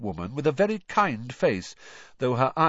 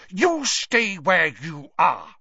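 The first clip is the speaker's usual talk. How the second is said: loud, high, slow command; stern mother; 8 semitones higher, 7 dB louder, slower than usual. A narrator's reading. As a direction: order the child firmly to stay put. Mother orders son to stay put.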